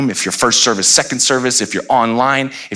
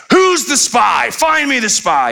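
angry king; slower than usual. Acted. King of Syria demands his court find the spy, high angry shout. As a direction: bark at the court to find the traitor.

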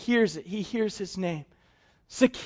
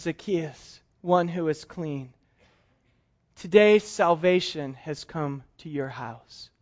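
much softer and lower, slow and tender; tender reassurance; 5 semitones lower, 4 dB quieter than usual. Acted. Jesus reassures Zacchaeus his past is over, soft and tender. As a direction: gently tell him he is forgiven. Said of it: "And Jesus looks at him and says".

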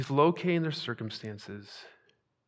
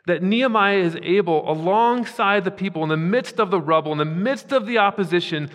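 loud, higher, driving repeated phrases; full-force preaching; 4 semitones higher, 7 dB louder than usual. Acted. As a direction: preach it with rising fervor.

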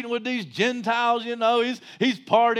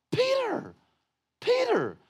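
calling Peter out; 12 semitones higher, 4 dB quieter, slower than usual. Acted.

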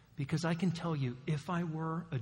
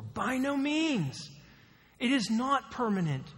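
much higher and louder, slow and forceful; emphatic denial; 9 semitones higher, 6 dB louder, slower than usual. Acted. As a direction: slam down each word like a verdict. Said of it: "the same emphatic terms".

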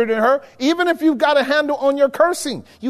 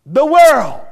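mock defiant cry; 3 semitones higher, 7 dB louder than usual. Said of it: "Eve said".

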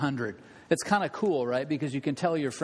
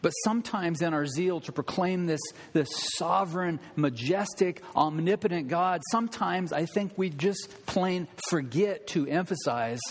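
ordinary delivery

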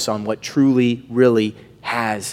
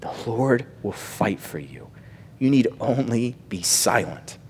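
softly repeated; 7 dB quieter than usual. Real, not acted. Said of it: "Get out the way".

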